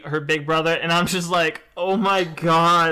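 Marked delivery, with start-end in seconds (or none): 1.8-2.9 exasperated oh my god. Retold or imitated. Reacting to sad anime scene.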